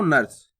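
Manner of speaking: नर्स in one quick, low syllable, "nars"; no vowel between r and s in नर्स; quick half "r". Shown as a demonstration. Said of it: "तेजी से उच्चारण करना".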